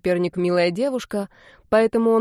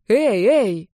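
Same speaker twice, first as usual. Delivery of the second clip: shouting; 2 semitones higher, 5 dB louder than usual. Acted. Calling into dead phone.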